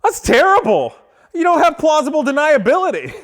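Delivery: high-pitched mock-appalled outburst; mock outrage. Joker heckling salesman over the speed display, mock outrage. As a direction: blurt it out in feigned dismay.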